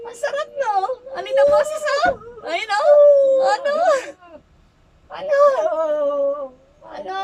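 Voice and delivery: high, very loud challenge; forceful high-pitched goading; shouted taunt. Real, not acted. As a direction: shout it at a suffering opponent. Healer taunting spirit during treatment.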